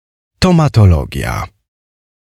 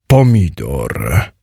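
low, slow giant voice; stolid giant; 6 semitones lower, slower than usual. A narrator's reading. Giant answers what is in the chest, stolid. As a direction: drag „Pomidor” out deep and deadpan. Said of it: "odpowiedział olbrzym".

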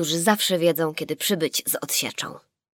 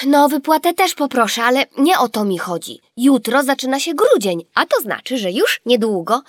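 high, louder eager explanation; eager girl; 6 semitones higher, 8 dB louder than usual. A narrator's reading. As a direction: rush on with excitement. Gabrysia answers father eagerly. (żywo, z zapałem)

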